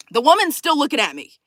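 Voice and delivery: ordinary talk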